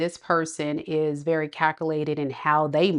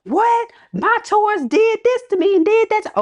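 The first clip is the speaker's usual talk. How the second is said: high, loud whiny outrage; mock complaining commenter; 4 dB louder than usual. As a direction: mimic a viewer crying foul. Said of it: "some of y'all be like".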